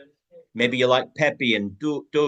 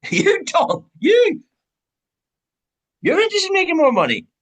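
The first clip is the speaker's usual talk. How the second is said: much higher and louder, delighted; excited realisation; 7 dB louder than usual. Acted. Spotting that the friend himself wants money, thrilled. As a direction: pounce on him with glee.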